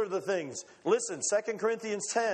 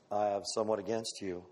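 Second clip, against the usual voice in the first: low, soft, grave verdict; God's voice; 10 semitones lower, 5 dB quieter, faster than usual. Acted. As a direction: pronounce it quietly as God. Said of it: "God says".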